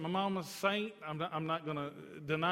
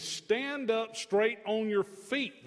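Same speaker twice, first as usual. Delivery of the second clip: higher, slow, firm preacher's command; loud command; 3 semitones higher, 3 dB louder, much slower than usual. Retold or imitated. Preacher voicing the healer to the man, slow loud command. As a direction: draw out each word as an order. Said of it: "he commands with a loud voice".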